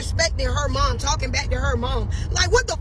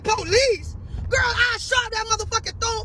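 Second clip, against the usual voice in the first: higher, shouted threat at cousin; retold shout; 7 semitones higher than usual. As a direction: bark it at the mouthy cousin.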